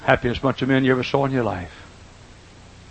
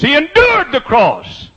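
much higher, louder, slow drawn-out endured; full preaching cry; 8 dB louder, slower than usual. Shown as a demonstration. Preacher crying it out to the congregation, drawn out and loud. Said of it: "Didn't say he shouted through the cross".

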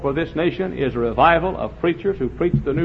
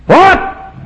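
leaps much higher and louder in shock; astonished what; 11 dB louder than usual. Acted. Pastor astonished the puzzle is done, shocked. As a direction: blurt it in disbelief.

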